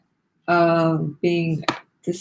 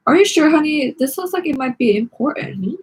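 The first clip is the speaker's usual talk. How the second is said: curious grandma; 7 semitones higher than usual. A narrator's reading. Grandma asking Nikki about the form, higher and sweet. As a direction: coax her gently like a granny.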